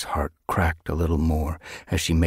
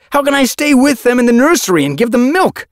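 much higher, loud voiced tom; high protesting tom-cat; 11 dB louder than usual. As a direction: push back in a high desperate voice. Ragged Pelt protesting.

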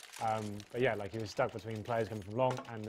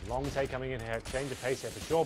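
esports caster; 4 semitones higher, 7 dB louder than usual. Real, not acted. Live CS play-by-play.

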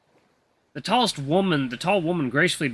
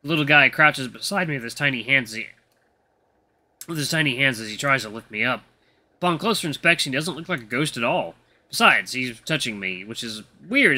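usual speaking voice

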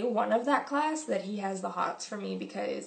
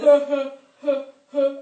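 loud, high fake laugh; forced awkward laugh; 4 semitones higher, 9 dB louder than usual. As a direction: fake a clumsy chuckle. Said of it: "and he goes like this".

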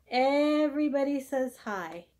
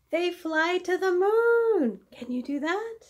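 excited gasp; 6 semitones higher than usual. Acted. Excited about flying to the moon.

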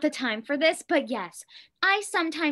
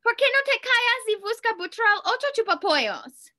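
angry retort; 9 semitones higher than usual. Acted. Willow insults the goon in Spanish.

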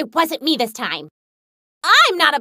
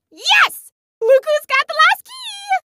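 high-pitched triumphant crowing; gleeful gloating; 13 semitones higher than usual. Acted. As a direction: crow with delight, pitched way up. Gloating over finding the key.

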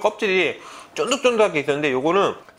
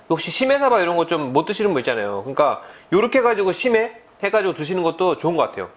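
ordinary talk, nothing marked

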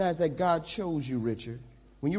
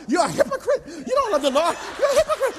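high, loud teasing taunt with laughter; mock taunting; 11 semitones higher, 6 dB louder, faster than usual. Trainer taunting the pastor to run faster, loud and high. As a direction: goad him, laughing.

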